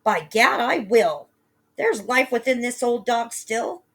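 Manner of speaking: put-on loud, determined old woman; bold cry. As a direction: cry out with gritty pride. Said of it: "The mother cried".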